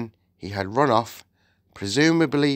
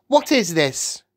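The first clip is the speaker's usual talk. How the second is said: put-on demanding boy; 7 semitones higher, 4 dB louder than usual. A narrator's reading. Uneasy boy questions the witch.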